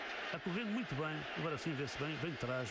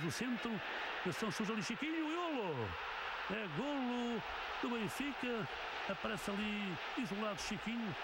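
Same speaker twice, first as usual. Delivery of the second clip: goal cry, pitched way up; excited goal call; 6 semitones higher than usual. Real, not acted. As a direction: let the goal burst out high. Second goal called live.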